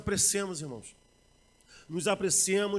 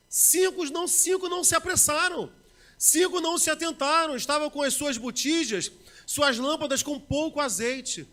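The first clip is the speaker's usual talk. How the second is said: high, loud, ringing pulpit voice; a pastor booming the parable of the virgins; full-force preaching; 6 semitones higher, 5 dB louder, slower than usual. Acted. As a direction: preach it out to the back row.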